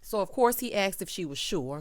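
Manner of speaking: ordinary talk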